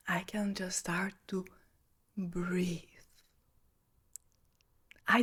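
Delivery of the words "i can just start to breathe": unvoiced, hushed and much softer; soft whisper. Shown as a demonstration. Showing how she just breathes in the sauna, whispering. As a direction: breathe the words out like a calm exhale. Demonstrating calm breathing instead.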